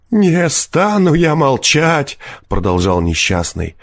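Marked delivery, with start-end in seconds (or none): 0.1-2.2 high, drawn-out refusal; fierce defiance. A narrator's reading. Wretched peasant answering forester, fierce defiance. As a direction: refuse in open fury. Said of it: "подхватил мужик свирепым голосом".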